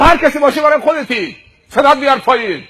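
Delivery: high, loud scolding; angry telling-off. Acted. Men quarrelling at the car window, angry telling-off. Quarrel between driver and stranger.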